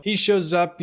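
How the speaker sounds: usual speaking voice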